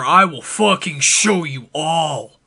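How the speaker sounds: ordinary delivery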